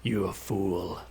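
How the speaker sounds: slow, low, hushed villain voice; Shao Kahn whisper. Retold or imitated. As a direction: menace them in a slow hush. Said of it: "I prefer when Shao Kahn whispers".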